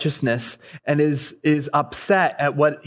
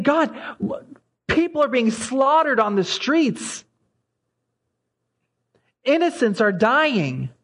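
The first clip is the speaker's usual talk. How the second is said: mock anguished shriek; 8 semitones higher than usual. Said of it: "It's a shrieking out to God".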